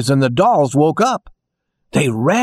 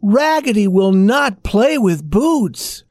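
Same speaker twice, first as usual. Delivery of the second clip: higher, slow, drawn-out doll voice; dolls' chorus; 7 semitones higher, slower than usual. A narrator's reading. Dolls warning the kitten together, slow and high. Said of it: "said all of the dolls".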